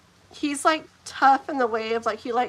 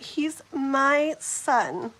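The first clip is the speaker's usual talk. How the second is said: drawn out word by word; slow, pointed delivery at usual pitch; slow, firm statement; much slower than usual. Acted. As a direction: stretch each word to stand your ground. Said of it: "like that".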